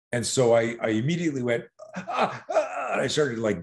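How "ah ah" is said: high, short gasps as if winded; fake gasping. Boy fakes being winded so the bully lets go. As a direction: pretend you can't breathe. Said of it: "gasping and pretending I couldn't breathe".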